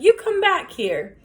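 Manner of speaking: high, loud, slow yell after a thief; shouting. A narrator's reading. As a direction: shout after the fleeing dog. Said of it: "Ernest shouted".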